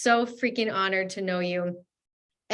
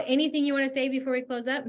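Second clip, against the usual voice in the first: usual speaking voice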